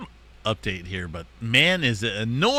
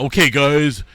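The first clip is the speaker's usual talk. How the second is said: scripted hype intro; 4 semitones higher, 4 dB louder than usual. Retold or imitated. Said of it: "key up my updates with".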